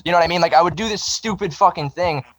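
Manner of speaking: normal talking voice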